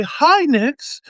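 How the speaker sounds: very high greeting. Shown as a demonstration. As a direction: say it high and light. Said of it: "So I can say".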